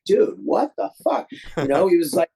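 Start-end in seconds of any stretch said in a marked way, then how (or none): 0.0-1.3 high, slow imitated outburst of disbelief; mock disbelief. Booth guy reacting to the film he screened, high slow mock disbelief. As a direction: stretch it out high like a stunned guy.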